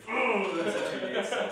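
laughing. Real, not acted. Laughing at a chip.

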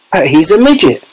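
high, mildly shouted astonishment. A narrator's reading. Father sees his shrunken son, astonished mild shout. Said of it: "shouted Mister Teavee".

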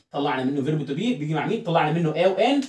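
normal talking voice

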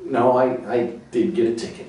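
ordinary delivery

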